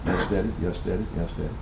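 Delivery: imitated low, hushed answers; children's meek replies. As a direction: echo obedient children softly, one after another. Voices his children's replies in turn.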